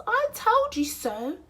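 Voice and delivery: very high mouse voice; smug mouse. Acted. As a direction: gloat in a tiny high voice. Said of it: "said Mouse".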